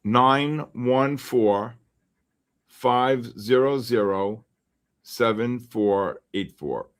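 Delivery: slow, each digit set apart; slow dictation. Real, not acted. Trader repeats his phone number for viewers, slowly and deliberately. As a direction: spell the number out slowly so viewers can write it down.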